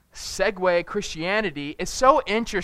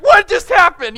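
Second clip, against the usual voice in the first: high, loud, disbelieving yell; panicked shout; 13 dB louder than usual. Retold or imitated. Father reliving his daughter's choking, panicked shout. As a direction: shout it as if your baby nearly choked. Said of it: "and I'm just like".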